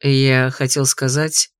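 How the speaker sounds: low, slow, insistent; stubborn. Acted. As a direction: press on stubbornly. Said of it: "упрямо продолжил Арчи Червинг".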